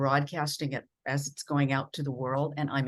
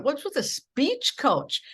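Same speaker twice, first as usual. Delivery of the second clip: higher, slow, disbelieving "speech coach"; incredulous question; 10 semitones higher, slower than usual. Retold or imitated. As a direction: ask it as if the idea is absurd. Retold incredulous reply to boss.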